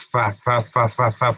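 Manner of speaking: "fa" repeated with a blown f; short repeated "fa", leaning on the f; demonstrated F sound. Shown as a demonstration. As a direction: puff air through the f of each "fa". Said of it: "El sonido de la F".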